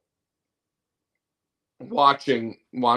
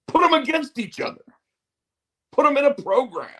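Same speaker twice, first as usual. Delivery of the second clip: exasperated outburst; 13 semitones higher, 4 dB louder, faster than usual. Real, not acted. Ranting at wrestling companies.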